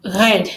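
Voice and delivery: "red" with a guttural French R. Shown as a demonstration. Teacher showing viewers how the French would say it, "red" with guttural R. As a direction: start "red" on a throaty French R. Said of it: "If the French are gonna pronounce red".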